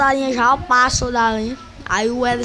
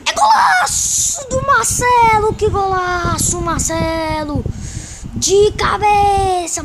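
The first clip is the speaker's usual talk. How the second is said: explosive goal commentary; 5 semitones higher than usual. Real, not acted. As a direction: belt out the golazo. Celebrating Marcelo's goal.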